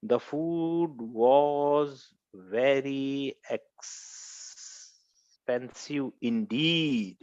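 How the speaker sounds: very slow, drawn-out example sentence; slow dictation. Shown as a demonstration. Teacher reading an example to the class, slow dictation. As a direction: spell it out slowly with long pauses.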